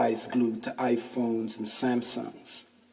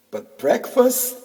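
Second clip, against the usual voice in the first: much higher incredulous question; 12 semitones higher, 7 dB louder than usual. Real, not acted. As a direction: scoff at the very idea. Scoffing at corner store breakfast.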